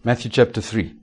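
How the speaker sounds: normal talking voice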